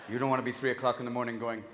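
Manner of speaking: ordinary delivery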